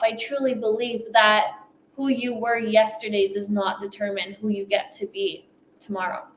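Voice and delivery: ordinary talk, nothing marked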